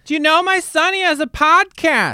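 high-pitched imitation of a boasting mother; bragging mom. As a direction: announce it proudly as an embarrassing mom. Acting out mom bragging to star.

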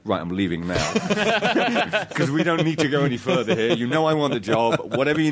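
high, laughing mock exit; laughing retold exit. Actor retells leaping up at the offer, laughing high. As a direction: joke you're walking out already. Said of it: "I just got up and went".

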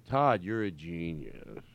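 slow, low mock compliment; imitated sarcastic tinge. Comedian shows the guest their sarcastic tinge, slowly imitated. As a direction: imitate faint sarcasm, trailing slowly at the end. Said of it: "There's a tinge at the end".

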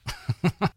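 short quiet chuckle; a little laugh to oneself; brief real chuckle; soft laugh. Real, not acted. Laugh alone.

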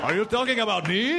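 high-pitched, put-on celebrity impression; George Romero. Director interrupting the panel that discusses him, bad impression. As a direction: butt in as the man being discussed, in a put-on voice. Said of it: "Romero's here".

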